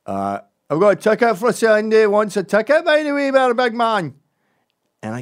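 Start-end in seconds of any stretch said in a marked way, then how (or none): 0.7-4.1 loud, high, put-on Scottish; Scottish accent. American hawking a spare ticket in Glasgow, put-on Scottish accent. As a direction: call out loudly in an exaggerated Scots accent. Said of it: "So I always do a Scottish accent there".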